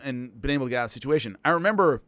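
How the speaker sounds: ordinary delivery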